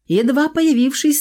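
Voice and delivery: ordinary delivery, nothing marked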